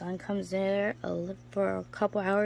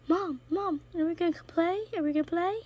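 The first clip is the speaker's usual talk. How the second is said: lightly voiced eager pet, higher; gecko's voice; 9 semitones higher, faster than usual. Voicing her gecko begging mom to play, higher eager voice. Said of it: "She's like".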